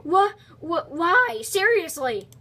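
high, loud, stammered protest; exasperated disbelief. Real, not acted. Baffled by failing in the game.

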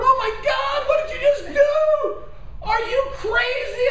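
high, loud, laughing mock horror; shocked disbelief. Husband to wife after the shot, high laughing disbelief. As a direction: shriek it at her half laughing.

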